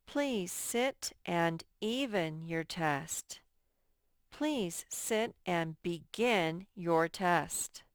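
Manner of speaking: very slow, each word spaced out; slow dictation. Shown as a demonstration. Teacher to pupils, reading the test sentence very slowly. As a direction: read it slowly for young spellers. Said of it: "teacher reads sentence slowly".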